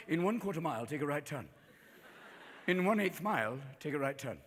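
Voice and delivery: soft, low, prim English GPS; English accent with curt condescension; snooty English navigation voice; bitchy English voice. Acted. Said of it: "it's a bitchy English voice".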